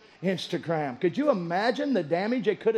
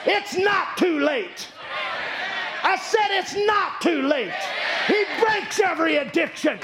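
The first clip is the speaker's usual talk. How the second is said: preaching at full force; 10 semitones higher than usual. Acted. Preacher declaring hope to the congregation, full-force preaching. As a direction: lift the room with hope.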